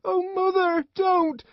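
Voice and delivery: put-on very high child's plea; crying out. A narrator's reading. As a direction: beg loudly in a little boy's high voice. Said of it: "he cried out".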